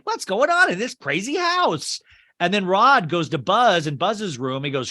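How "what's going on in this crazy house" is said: high, baffled cop voice; bewildered cop. Retold or imitated. Policeman hit by falling bags, high and bewildered. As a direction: ask like an overwhelmed cop. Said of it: "the cop Harry's just like".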